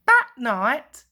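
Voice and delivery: plain reading voice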